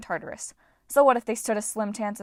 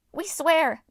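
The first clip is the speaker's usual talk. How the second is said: eager; 8 semitones higher, 6 dB louder than usual. A narrator's reading. Eager dwarf oath.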